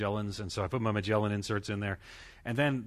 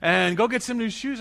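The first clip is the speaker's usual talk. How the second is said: higher, louder wife snapping at her whining husband; scolding wife; 11 semitones higher, 7 dB louder than usual. Retold or imitated. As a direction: play the fed-up wife ordering him out. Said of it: "She says".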